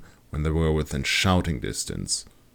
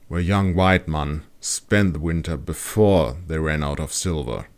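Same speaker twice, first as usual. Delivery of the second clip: usual speaking voice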